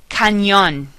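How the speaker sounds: Spanish "Cañón", as "kah-NYOHN". Shown as a demonstration. Teacher giving words to practice, NY sound in "Cañón". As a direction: say "Cañón" with NY, stress the end. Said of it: "N Y like in the word canyon".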